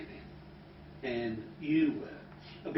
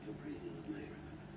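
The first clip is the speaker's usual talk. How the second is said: hypnotic calm voice; 6 semitones lower, 16 dB quieter than usual. Shown as a demonstration. Hypnotist guiding a client's breathing, very soft. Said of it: "Just breathe".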